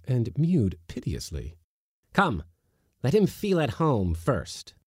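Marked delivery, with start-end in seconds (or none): 2.1-4.7 high, teacherly command. A narrator's reading. Uncle commanding Praskovia to settle the kitten, high. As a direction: give the order like a master. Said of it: "Pyotr Demyanich commanded".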